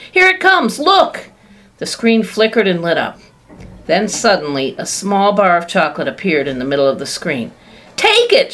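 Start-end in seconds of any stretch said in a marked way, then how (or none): 0.1-1.3 very high and loud; almost squealing with excitement; excited cry. A narrator's reading. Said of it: "he cried". 7.9-8.5 very high, loud command; shout. A narrator's reading. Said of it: "shouted Mister Wonka".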